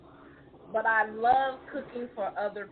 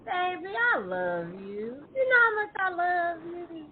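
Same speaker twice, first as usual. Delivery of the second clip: buttering-up sweet talk; 7 semitones higher than usual. Retold or imitated. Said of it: "when you come talking about".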